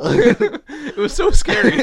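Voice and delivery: high, slow, through laughter; real laughter breaking into the words; laughing. Real, not acted. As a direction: laugh helplessly while admitting fright. Laughing over remembered fright.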